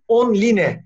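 "online" read letter by letter as on-li-ne; read as spelled. Shown as a demonstration. Speaker showing how it is written, "online" read as on-li-ne. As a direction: say "online" the Turkish spelling way, on-LEE-neh. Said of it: "şimdi okudum".

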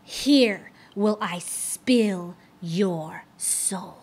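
slow, whispered death threat; menacing whisper. Acted. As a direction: let the threat creep out slowly. Fiend's final threat.

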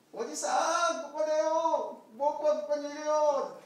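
high, pleading little voice; lamb calling for help. Acted. Lost lamb calling up for rescue, high and pleading. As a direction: cry for help from far below.

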